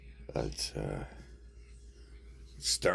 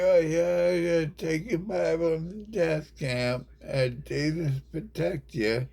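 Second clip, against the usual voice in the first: higher, lightly squeaky sneering blessing; mock pious voice; 12 semitones higher, 4 dB louder than usual. Speaker mocking believers headed to the camp, higher sneering voice. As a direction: fake a sweet blessing, pitched up. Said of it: "and then you be like".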